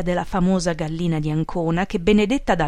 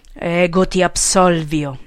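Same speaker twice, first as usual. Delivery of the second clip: slow, louder, contained anger; bursting with rage; 5 dB louder, slower than usual. A narrator's reading. Boss absolves his follower in Latin, seething. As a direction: force it out while seething. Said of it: "che schiattava dalla rabbia".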